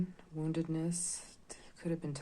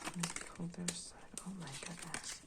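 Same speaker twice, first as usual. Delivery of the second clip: whispering; 3 semitones higher, 8 dB quieter than usual. Real, not acted. Pulling cards, reacting quietly.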